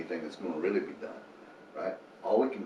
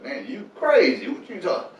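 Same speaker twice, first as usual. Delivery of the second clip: incredulous scoff; 12 semitones higher, 11 dB louder than usual. Retold or imitated. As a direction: play the skeptic who thinks he's lost it. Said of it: "A lot of people are like".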